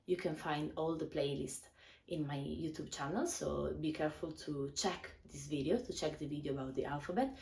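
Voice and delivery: ordinary talk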